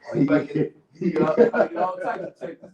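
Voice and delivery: laughing. Real, not acted.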